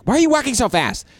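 high, whiny imitation of her; girlfriend's high mock whine; mimicked whiny girl. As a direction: squeak it like the annoyed girlfriend. Girl's annoyed question retold.

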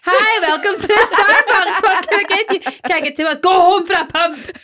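high, loud, laughing mock barista. Host imitates a Starbucks barista, high and giggly. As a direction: play a cheery barista through laughter. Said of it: "Imagine one".

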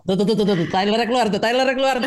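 rising, excited laughing chatter; giddy, laughing call-out; excited teasing. Real, not acted. Excitedly pointing out Tyler, laughing.

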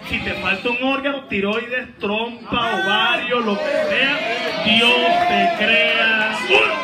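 loud, building preacher's shout, drawn out at the end; rallying repeat. Real, not acted. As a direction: roar the promise to rouse the congregation. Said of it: "Cuando usted responde, se desata". (prédica a gritos que va en aumento)

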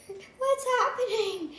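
high, slow, drawn-out question; drawn-out, high-pitched curiosity; curious peek. Acted. Player pokes head in quietly.

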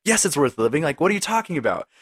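arguing with yourself. Retold or imitated. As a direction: snap back at the voice in your head, mock-exasperated. Arguing back at own dark thought.

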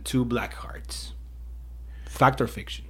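slow, hushed whisper; dramatic whisper. Acted. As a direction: breathe it out slowly like a secret. Quiz question dramatic reveal.

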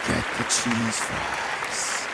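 slow Jesus voice. Retold or imitated. Voicing Jesus again.

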